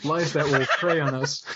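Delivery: slow Southern drawl on a grim line; half-joking Southern voiceover; Southern accent. Retold or imitated. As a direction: lean into a mock Southern narrator. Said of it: "I don't know why I'm doing Southern".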